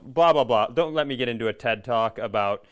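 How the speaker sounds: normal talking voice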